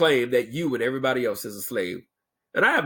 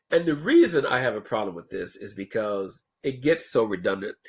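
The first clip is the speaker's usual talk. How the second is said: usual speaking voice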